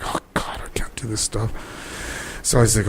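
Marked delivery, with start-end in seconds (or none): 0.1-1.5 whisper. Retold or imitated. Nervous actor rehearsing alone.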